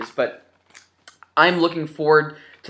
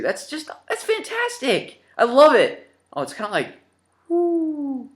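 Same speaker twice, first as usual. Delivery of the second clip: delighted; 11 semitones higher, slower than usual. Real, not acted. Thrilled unboxing a Mario sprite.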